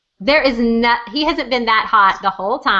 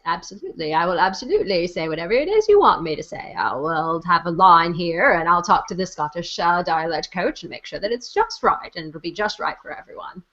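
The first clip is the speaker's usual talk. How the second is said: Diana impression; 6 semitones lower than usual. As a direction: do Diana as Jimmy Stewart would. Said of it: "That's my Diana impression".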